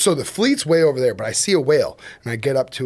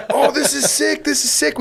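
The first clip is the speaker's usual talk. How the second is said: high, laughing delight; thrilled; 11 semitones higher than usual. Retold or imitated. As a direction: gush with laughing joy. Client thrilled by fight.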